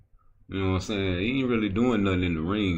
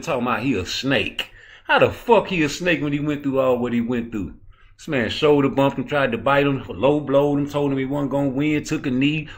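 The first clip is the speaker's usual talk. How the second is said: angry rant; 5 semitones higher than usual. Real, not acted. Heated rant against critics.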